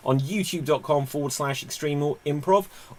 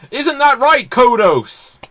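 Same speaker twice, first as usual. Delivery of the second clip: loud, higher menacing alien voice; alien villain; 9 semitones higher, 10 dB louder, slower than usual. Acted. Kang asking Kodos, menacing alien boom. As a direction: sneer it to your fellow invader.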